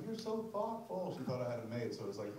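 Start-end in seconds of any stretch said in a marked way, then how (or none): 0.0-1.1 higher, slow, a mild mock of his sweet wife; lightly voiced touched wife; imitating wife. His wife thanking him for the gift, mildly mimicked. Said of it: "she's like".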